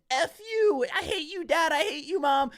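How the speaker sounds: high-pitched mock scream at parents. Date suddenly screaming at her mom and dad on the phone, mock screaming. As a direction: act out a furious, high-voiced tantrum. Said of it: "she starts screaming".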